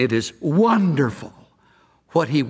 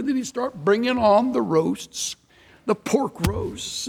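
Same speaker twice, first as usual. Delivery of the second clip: normal talking voice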